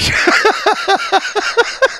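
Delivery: long high breath-heavy cackle; wheezing laugh. Real, not acted. Storyteller laughing at his naive reasoning, wheezing. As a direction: crack up at the absurd logic. Amused by absurd logic.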